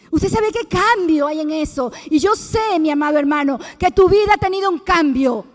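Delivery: rising preacher's fervour; fervent preaching. Acted. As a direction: preach it to the congregation. Preaching to the congregation.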